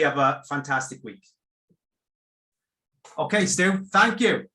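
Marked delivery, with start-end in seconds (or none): 3.2-4.5 higher, louder parting thanks; cheery goodbye. Real, not acted. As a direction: lift the voice for a happy farewell. Host's final thanks to guest.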